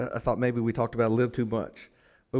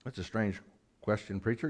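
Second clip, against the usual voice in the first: low, quiet interjection; hushed aside, lower than the sermon; imagined listener's aside; 3 semitones lower, 8 dB quieter than usual. Acted. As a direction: drop low and soft as a doubting churchgoer. Voices a listener questioning the preacher.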